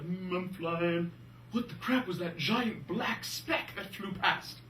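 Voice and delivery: slow, drawn-out cartoon pilot voice; oblivious pilot. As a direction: play a puzzled pilot, drawing words out. Acting out the pilots' reaction.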